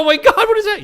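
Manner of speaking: very high, loud, strained mock terror; panicked shriek. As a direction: shriek it like you're losing your mind. Retold paranoia about looping.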